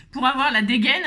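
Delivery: laughing through the pun. Real, not acted. Crafter joking about her girdle, laughing. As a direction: laugh it out, amused at herself.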